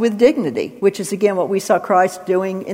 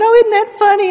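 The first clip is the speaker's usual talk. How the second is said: much higher, silly tittering voice; mock the giddy TV crowd, pitched way up; mock TV giggler; 12 semitones higher, 4 dB louder than usual. Preacher mocking late night TV audiences laughing at sin, high silly voice.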